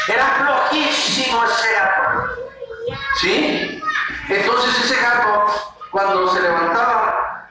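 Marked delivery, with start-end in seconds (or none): none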